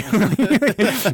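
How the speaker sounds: laughing. Real, not acted. Laughing at the absurd scene.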